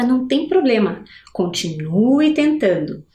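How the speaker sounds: normal talking voice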